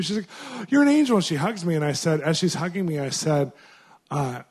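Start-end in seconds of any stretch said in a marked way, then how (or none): 0.7-1.2 high, loud burst of gratitude; overjoyed thanks. Retold or imitated. Grateful woman hugging her helper, high joyful cry. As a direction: gush it like he saved her day.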